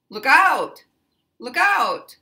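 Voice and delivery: emphatic warning. Acted. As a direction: call out a sharp warning, leaning on "out". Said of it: "people might put emphasis on it".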